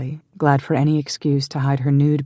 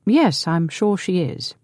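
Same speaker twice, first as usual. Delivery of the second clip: slow, dry sarcastic drawl; sarcastic older brother; 5 semitones higher, slower than usual. A narrator's reading. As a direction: let the disbelief show. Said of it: "Roger said sarcastically".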